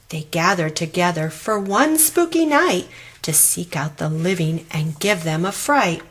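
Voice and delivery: ordinary delivery, nothing marked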